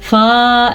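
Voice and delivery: "فا" with the vowel drawn out; wrongly lengthened vowel. Shown as a demonstration. Teacher showing learners the wrong reading, "فا" held too long. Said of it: "ایسے پڑھنا غلط ہے".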